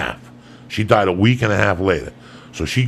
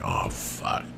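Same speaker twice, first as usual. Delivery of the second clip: whisper; 10 dB quieter than usual. Retold or imitated. His own dread when woken.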